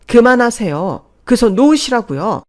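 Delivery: high, loud, urgent order; shouted demand. Acted. Daughter-in-law pushes mother-in-law off her mother, shouting. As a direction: shout to break up the fight.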